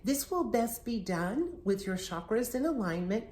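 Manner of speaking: ordinary talk, nothing marked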